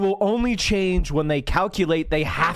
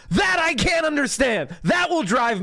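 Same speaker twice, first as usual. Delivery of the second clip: high, raised exasperation; exasperated shout; 7 semitones higher than usual. Real, not acted. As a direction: burst out in disbelief. Ranting about smoothie cure.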